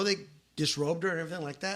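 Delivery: ordinary talk, nothing marked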